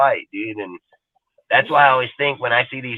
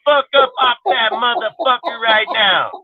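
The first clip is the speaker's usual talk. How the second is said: raised, higher angry rant; retold angry yelling; 7 semitones higher than usual. Telling off the friend picking lint from the carpet, angrily. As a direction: chew him out at full tilt.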